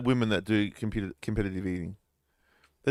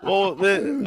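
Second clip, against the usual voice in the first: real laughter breaking through; talking through a real laugh; 7 semitones higher, 5 dB louder than usual. Real, not acted. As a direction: laugh out the words.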